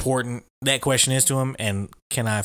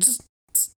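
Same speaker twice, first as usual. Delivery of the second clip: buzzing noise; 13 semitones higher, 9 dB quieter than usual. Retold or imitated. Mimicking recliner sound.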